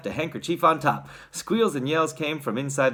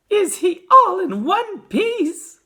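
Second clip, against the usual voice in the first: very high, loud, drawn-out cry; shrill, slow, desperate question; anxious cry; 10 dB louder, much slower than usual. A narrator's reading. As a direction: cry it out like a frantic mother. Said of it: "cried Missus T V".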